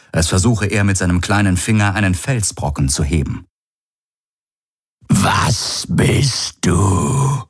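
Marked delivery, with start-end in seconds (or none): 5.1-7.4 slow eerie question rising at the end; drawn-out alien cry, mildly voiced; shrilling telepathic voice. A narrator's reading. Creature's voice echoes through Galen's head, mildly shrill. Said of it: "gellte die telepathische Stimme".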